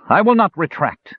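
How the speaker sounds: high, firm and unyielding; defiant high-pitched no; resolute refusal. Acted. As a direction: dig in and refuse. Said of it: "the elf said resolutely".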